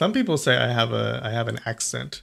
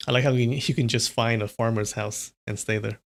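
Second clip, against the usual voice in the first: plain reading voice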